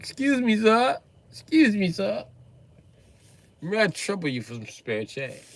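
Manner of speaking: lightly voiced polite British begging; a hint of a British accent, asking sweetly; British beggar girl. A British girl begging a stranger for change, lightly accented. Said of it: "your British ass".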